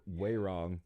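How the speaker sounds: ordinary delivery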